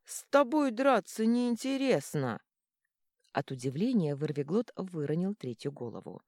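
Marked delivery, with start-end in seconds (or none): 0.0-2.4 much higher, louder sulking whine; whiny monster. A narrator's reading. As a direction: whine like a bored beast. Dragon head complains. (капризное нытьё змея)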